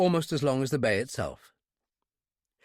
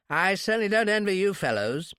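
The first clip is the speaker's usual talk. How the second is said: high-class English, pitched up; exaggerated British accent; 6 semitones higher than usual. A narrator's reading. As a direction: sympathise in affected upper-class English. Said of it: "high-class English accent".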